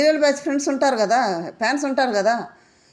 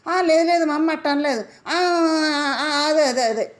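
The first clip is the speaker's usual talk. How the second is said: imitating a higher, whiny flustered stammer; hammed-up caught-out fumbling, a bit higher; mock flustered denial; 4 semitones higher, slower than usual. Vlogger mimics Nikhil denying his mother's advice, mock flustered whine. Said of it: "అన్న తర్వాత ఎట్లా అంటే".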